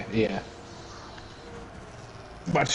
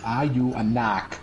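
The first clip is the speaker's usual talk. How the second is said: slow, drawn-out Boston imitation; mock Boston accent; slower than usual. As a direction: stretch it out like a Boston cop. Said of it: "Are you or aren't you a knock?".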